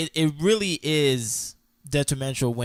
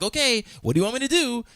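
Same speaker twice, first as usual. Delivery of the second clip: fast, much higher, eager to help; 10 semitones higher, faster than usual. Retold or imitated. Woman ready to solve her man's problem, fast and eager. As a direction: jump in ready to fix it. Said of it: "up to the challenge".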